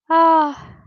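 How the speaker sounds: ordinary talk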